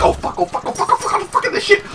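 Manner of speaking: high, frantic run of curses; retold panic. Gamer recalls a giant fly passing, retold panic. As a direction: reenact a panicked scramble in a high voice.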